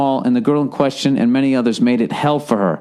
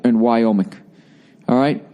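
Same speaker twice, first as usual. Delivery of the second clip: usual speaking voice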